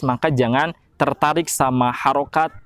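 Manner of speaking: ordinary talk, nothing marked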